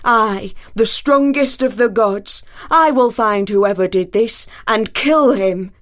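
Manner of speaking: raging. Acted. Thor rages at whoever cut his wife's hair. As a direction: rage and vow revenge. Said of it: "raged Thor".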